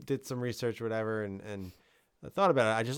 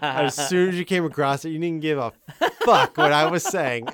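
laughing; 4 semitones higher, 8 dB louder than usual. Real, not acted. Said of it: "I'm just laughing".

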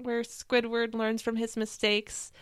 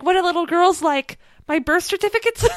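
puzzled Squidward; 6 semitones higher, 7 dB louder than usual. Retold or imitated. Voicing Squidward's clueless gift question.

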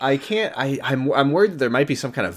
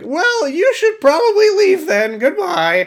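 very high, slow dismissive robot; high chirpy AI voice; 5 dB louder, slower than usual. Retold or imitated. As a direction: shoo him out in a squeaky chirp.

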